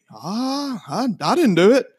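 high, whiny denial; mimicking the mechanic. Owner imitating the mechanic's denial, high and whiny. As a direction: play the guilty guy weaselling out. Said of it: "I was like".

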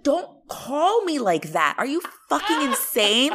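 high, indignant scolding; drawn-out, outraged telling-off in retelling; mad. Retold or imitated. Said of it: "I was so mad".

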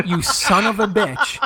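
high, slow, drawn-out indignation; retold anger. Pranked friend cursing the joker, mock-mad and drawn out. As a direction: imitate a friend who's just been had. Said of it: "like, all mad".

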